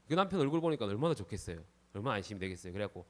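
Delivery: ordinary delivery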